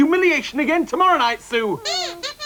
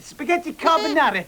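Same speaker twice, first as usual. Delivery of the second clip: mock Italian accent; 3 semitones lower than usual. Waiter covering ignorance for the diner, mock Italian accent. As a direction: announce it in hammed-up Italian English. Back to waiter act for customer.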